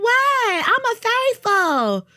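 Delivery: high indignant mock voice; high, slow, put-on indignant protest; mocking Portia. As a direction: play her high and affronted. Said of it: "She was like".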